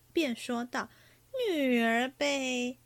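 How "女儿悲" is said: slow, drawn-out verse opening; stuck for words. A narrator's reading. Flustered oaf gropes for his verse, slow stalling recital. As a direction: stretch each syllable while stalling. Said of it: "薛蟠登时急得眼睛铃铛一般".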